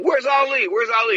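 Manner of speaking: high, urgent repeated question; anxious asking. Retold or imitated. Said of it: "'Cause I kept saying".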